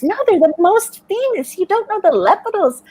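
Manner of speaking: put-on indignant French teen, French accent, pushing the point; French friends amazed she doesn't know the band, French accent. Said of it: "With their accent, it was coming out".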